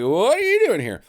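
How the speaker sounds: high, loud imitation of surprised intruders; mock startled group. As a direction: act out the gang barging in, pitch jumping up. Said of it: "they're like".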